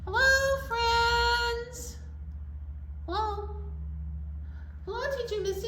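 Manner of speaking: high, sing-song puppet greeting; elephant puppet voice. Pretend. Elephant puppet greeting the kids and Teacher Missy, high sing-song. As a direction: chirp hello as a little elephant. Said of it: "Elephant would like to be our story time friend".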